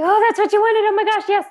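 high, loud mock squeal of joy; excited squealing. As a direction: imitate an overjoyed friend saying yes. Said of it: "they start screaming and stuff".

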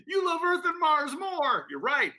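put-on high-pitched sulky kid voice; whiny hurt child. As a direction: whine like a jilted little kid. Playing the rejected child.

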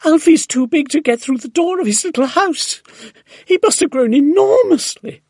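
high, loud, astonished woman's cries; excited shouting. A narrator's reading. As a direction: shout the alarming news upstairs. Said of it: "Missus Silver was shouting".

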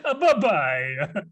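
put-on a failed French accent that lands Italian; bad Italian-sounding accent; mock Italian accent. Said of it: "He's still Italian".

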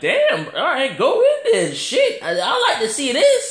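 high, laughing hype at the brawl; excited laugh. Retold or imitated. Watching the brawl start, high laughing hype. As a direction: cheer the fight on through laughter. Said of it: "I'm like".

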